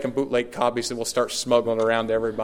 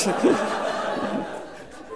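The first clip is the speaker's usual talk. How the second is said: laughing; 11 semitones higher than usual. Real, not acted. Laughs at his own reply.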